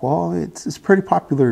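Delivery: a hint of a gentle grandmother; grandmother's voice.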